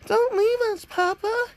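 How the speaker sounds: put-on children begging their papa not to leave; pleading children. The fake kids beg Papa to stay, in high pleading child voices. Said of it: "the other ones are just saying".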